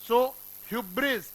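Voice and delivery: ordinary talk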